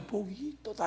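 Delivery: very soft, half-hearted recital; lackluster wrong example. Shown as a demonstration. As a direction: toss it off softly, without any proclamation. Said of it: "하지 말고".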